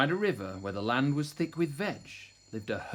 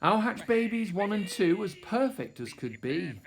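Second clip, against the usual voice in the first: plain reading voice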